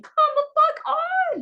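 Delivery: high exasperated outburst. Real, not acted. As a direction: throw up your hands in disbelief. Exasperated at snorkelers in Hawaii.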